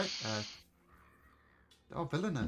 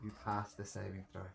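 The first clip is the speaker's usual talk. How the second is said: normal talking voice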